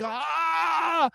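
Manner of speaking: high yelp of pain; pained scream. Retold or imitated. Boy answering what's wrong with a pained scream. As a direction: let out a burning-pain scream. Telling friends he's in pain.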